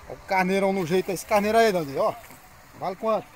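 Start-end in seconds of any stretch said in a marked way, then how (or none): none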